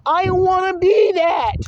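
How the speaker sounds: high, loud, drawn-out mock wail; hammed-up wounded whine; mock hurt feelings. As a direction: whine it like a thwarted child. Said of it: "because his feelings are dashed".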